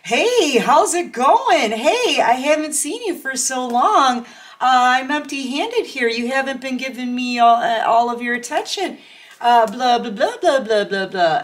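high, loud, overeager imitation of a clingy ex; mock the ex who barges back in all chummy; needy ex, imitated. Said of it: "all like".

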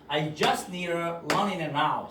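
I just need a running around를 천천히 이어서; 따라하도록 느리게 시범; slow model sentence. Shown as a demonstration. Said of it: "시작". (느린 시범 문장)

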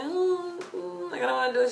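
high mumbled refusal; reluctant whine. Retold or imitated. Fan unwilling to interrupt mom, reluctant whine. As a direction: re-enact balking with a small whine.

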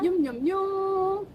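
slow, cute munching sounds; breathy cutesy giggly. Acted. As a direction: coo the yum-yum like a child. Cute eating noise.

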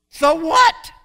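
high, loud and scoffing, the question tossed away; dismissive so what. Real, not acted. Preacher scoffing at the crowd to the congregation, loud and dismissive. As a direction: shrug it off loudly, like it hardly matters.

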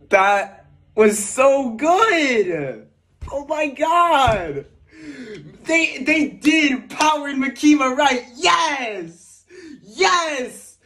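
high, stretched-out exclamations; thrilled fan celebrating; elated. Real, not acted. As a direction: cheer it out, overjoyed. Elated right after watching trailer.

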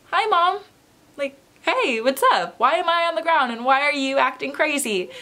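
mock-casual, high and oblivious; oblivious cheerfulness. Dazed, oblivious after waking from fainting.

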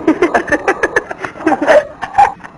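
high giggling fit; ticklish laugh. Acted. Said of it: "nakikiliti ako eh".

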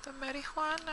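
very high, soft goofy echo; a lightly squeaky mock echo; silly high voice. Echoing his own word in a voice.

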